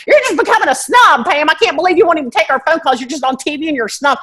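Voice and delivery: offended Southern friend teasing; Southern accent. Retold or imitated. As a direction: whine at her in a high Southern twang. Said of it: "you start getting that accent".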